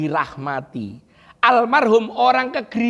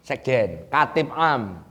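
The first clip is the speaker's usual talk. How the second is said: normal talking voice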